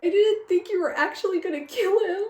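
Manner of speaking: high, loud, indignant outburst at the author; theatrically betrayed fan; mock outrage. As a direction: scold in high-pitched disbelief. Rants at the absent author.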